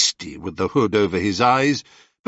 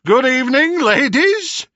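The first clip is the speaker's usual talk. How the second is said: louder, higher teasing greeting; mocking hero; 13 semitones higher, 5 dB louder than usual. Perseus taunting the sisters, mock polite. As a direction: taunt them with false politeness.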